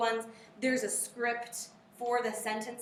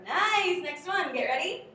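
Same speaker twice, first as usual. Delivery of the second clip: excited praise; 8 semitones higher, 8 dB louder, slower than usual. Real, not acted. Teacher cheering drill on.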